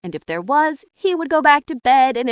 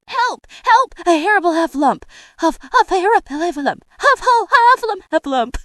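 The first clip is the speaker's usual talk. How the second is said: high, loud, puffing cries for help; frantic alarm broken by huffs; panicked cry; 7 semitones higher, 5 dB louder, faster than usual. A narrator's reading. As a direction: cry out while scampering off in terror. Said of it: "still crying out".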